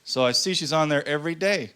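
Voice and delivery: ordinary delivery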